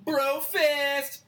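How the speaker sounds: high, punchy, drawn-out exclamation; mock wizard greeting. Meeting another wizard, high mock-heroic greeting. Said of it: "it's literally just like".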